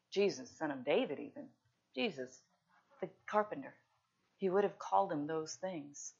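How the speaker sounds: ordinary talk, nothing marked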